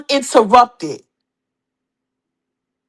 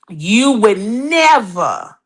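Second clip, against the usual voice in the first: very slow; 4 semitones lower, much slower than usual. Shown as a demonstration. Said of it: "repeats slower".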